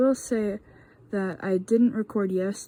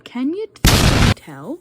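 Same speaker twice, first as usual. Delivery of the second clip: sudden loud, much higher, mocking outburst; sarcastic yell; 5 semitones higher, 14 dB louder than usual. Real, not acted. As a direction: blow up sarcastically. Sarcastic outburst about recording.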